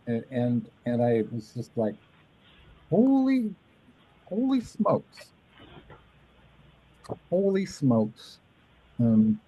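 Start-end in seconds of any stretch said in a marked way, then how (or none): 2.9-8.4 high, slow astonished holy smokes; drawn-out awe, almost squeaking; slow high amazement fading down; amazed. Retold or imitated. Amazed reaction to the book.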